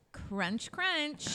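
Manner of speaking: crunch, crunch, rising then very high; playful crunch sound. Retold or imitated. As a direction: say it like a goofy sound effect. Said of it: "And he goes".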